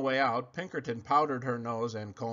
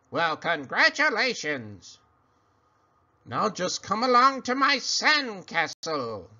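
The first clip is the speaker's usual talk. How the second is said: cackling creature; 7 semitones higher, 6 dB louder than usual. Acted. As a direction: croak like a little witch. Creature speaks.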